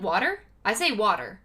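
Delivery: water in an American accent, clear r at the end; own American accent. Shown as a demonstration. Showing viewers own accent, American pronunciation of water. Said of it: "in my accent".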